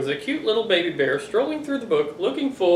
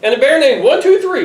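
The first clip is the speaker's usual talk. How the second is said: laughing; 6 semitones higher, 5 dB louder than usual. Real, not acted. Laughing through list.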